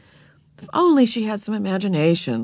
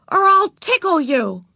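high, forceful inspired threat; threatening; 10 semitones higher, 4 dB louder than usual. A narrator's reading. Beezus finds a threat for Ramona, forcefully. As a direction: land the threat with sudden confidence. Sister triumphantly finishes threat.

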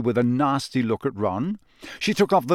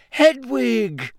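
higher, loud joyful shout; 11 semitones higher, 5 dB louder than usual. Acted. Harry shouting to Hedwig at the window. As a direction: call out the owl's name. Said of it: "he shouted".

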